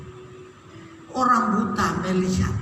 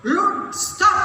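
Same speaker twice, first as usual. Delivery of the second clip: higher-pitched order to stop; acted-out crowd command; mock yell; 7 semitones higher than usual. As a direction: cut someone off like a scolding crowd.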